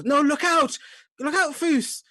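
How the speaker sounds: very high, loud warning scream; brother's alarmed yell. Acted. Brother warning the player mid-fight, panicked high yell. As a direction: shriek a desperate warning. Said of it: "you hear your brother go".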